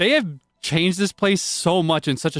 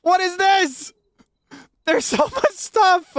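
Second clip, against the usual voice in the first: overwhelmed with joy at the changes; tearful delight; 13 semitones higher, 3 dB louder than usual. Real, not acted. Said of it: "I'm gonna cry".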